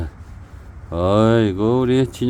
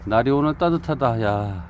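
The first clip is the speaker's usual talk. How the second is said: normal talking voice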